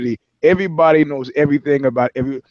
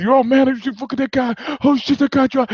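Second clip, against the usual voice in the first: frantic, higher-pitched rambling; flipping out; 10 semitones higher than usual. Retold or imitated. Shooter rattled after the knee shot, higher frantic babble. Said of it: "He's flipping out in the car".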